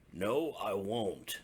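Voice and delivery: put-on Old Bear refusing the cub; stubborn old bear. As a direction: dig in your heels like a grumpy bear.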